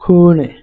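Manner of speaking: "খুনে" with a long umlaut vowel; model pronunciation. Shown as a demonstration. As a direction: hold the first vowel of "খুনে", then a short নে. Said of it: "ইউ umlaut এইচ".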